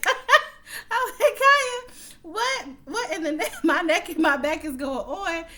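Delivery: high, loud laughing disbelief; shrieking with laughter at the crazy song; shocked laughing. Real, not acted. Shocked reaction to raunchy song.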